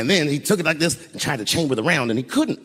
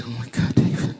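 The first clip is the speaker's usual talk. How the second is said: imitated crying; 3 semitones lower than usual. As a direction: act out a tearful, choked whimper. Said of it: "she's just crying".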